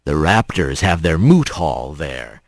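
low, clipped hawk sergeant voice; a stern, low police bird; gruff sergeant. Acted. To Rat, the sergeant in a low gruff voice.

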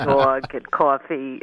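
thick Queens vowels, drawn out slowly; slow, heavy New York Queens accent. Shown as a demonstration. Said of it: "I used to talk like this".

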